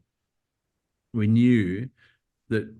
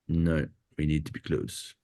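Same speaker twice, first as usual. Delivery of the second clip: a lower, softer put-on of the director's accent, self-admittedly bad; Denis's accent; 4 semitones lower, 4 dB quieter than usual. Cinematographer imitating his director's accent for an interviewer, a lower, softer, self-admittedly bad put-on. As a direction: imitate a colleague's accent, deliberately imperfect. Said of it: "that's a terrible impersonation of his amazing accent".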